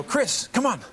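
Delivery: high-pitched mock summons; imitated calling out. Friends summon Chris to come along, imitated call.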